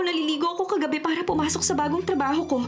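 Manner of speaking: ordinary delivery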